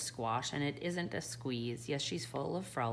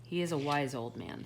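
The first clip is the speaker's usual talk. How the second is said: ordinary delivery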